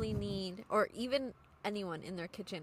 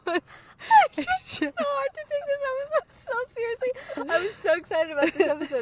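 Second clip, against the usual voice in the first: high, loud laughing complaint; 11 dB louder than usual. Real, not acted. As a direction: crack up while complaining. Cracking up mid-complaint.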